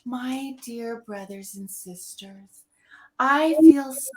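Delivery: slow, sweet mock pulpit tone; mimicking a saccharine conference talk; syrupy speaker voice. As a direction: drip syrup over the greeting. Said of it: "so mamby-pamby, sweet, dripping with syrup".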